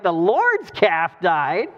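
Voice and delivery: slow, swooping disbelief with a rising end; incredulous question. Retold or imitated. Wife questions her husband about the calf, incredulous. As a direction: echo it back as a stunned question.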